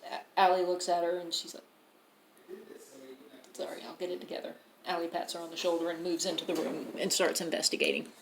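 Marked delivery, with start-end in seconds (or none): 3.5-4.5 quick, hushed, half-whispered apology; subdued apology. Acted. Shaken character apologises to a companion, quick and hushed. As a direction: murmur it fast, barely voiced.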